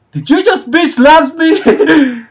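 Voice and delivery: high, slow outraged disbelief; shocked. Retold or imitated. Slapped man, to robber: high incredulous outrage. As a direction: play the stunned victim, voice jumping high. Victim reacts to slap, acted.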